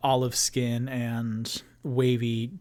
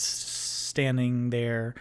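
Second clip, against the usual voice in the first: ordinary talk, nothing marked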